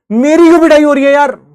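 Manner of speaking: high, loud aggrieved complaint; hammed-up wronged wail; indignant protest. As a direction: protest the injustice at full voice. Acted protest at unfair beating.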